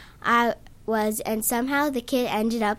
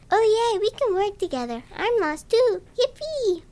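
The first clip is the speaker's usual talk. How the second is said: put-on much higher, gleeful child; excited high kid; 10 semitones higher than usual. A narrator's reading. Lost kid thrilled to find a partner, high and gleeful. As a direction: squeal it like a delighted little kid. Cheerful kid voiced in story.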